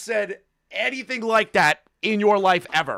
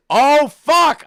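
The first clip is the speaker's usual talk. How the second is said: surprised outburst; 9 semitones higher, 9 dB louder than usual. Real, not acted. Sudden realisation while watching.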